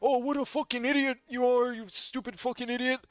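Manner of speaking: high, mocking insults; mock sneering chatter. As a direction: sneer it like a nasty fan. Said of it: "retellings are just so one-sided".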